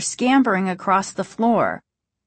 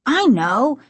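higher, sharp reply; a quick touch of annoyance; sharp retort; 5 semitones higher, 4 dB louder than usual. A narrator's reading. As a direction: answer sharply, a touch irritated. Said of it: "he said sharply".

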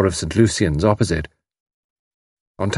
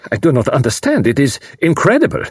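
higher, formal exclamation; bewildered foreigner; 6 semitones higher than usual. A narrator's reading. Carl reacts to the kidnapping note, incredulous. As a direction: protest in bewilderment, rising in pitch.

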